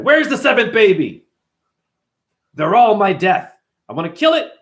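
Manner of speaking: imitating a furious, threatening villain; angry demand. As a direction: demand it loudly, acting the enraged villain. Acting out Kans demanding the baby.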